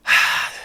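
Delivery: long breathy sigh; resigned sigh. Acted. Person talking themselves out of objecting, resigned sigh. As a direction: sigh as if letting it slide. Rationalizing to oneself before letting it go.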